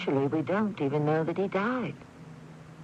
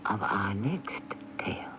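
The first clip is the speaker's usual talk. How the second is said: slow, breathy hush before the preview; spooky whisper; 5 semitones lower, 4 dB quieter than usual. Acted. Host hushing the radio audience into a slow whisper. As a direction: draw listeners in with a secret. Host teasing the next tale.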